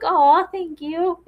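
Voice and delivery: high, slow and sweet; high, slow, sugary thank-you; sweet reply. Retold or imitated. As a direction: thank them like a doting grown-up talking to kids. Own sweet reply to kids.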